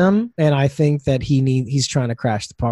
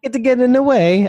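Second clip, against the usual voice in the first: put-on Italian accent; 9 semitones higher than usual. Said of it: "an Italian accent".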